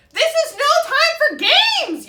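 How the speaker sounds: very high, very loud, slow; yelling. A narrator's reading. Goose yelling at the blue bird, huge slow shout. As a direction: bellow it stretched out. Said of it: "yelled Goose".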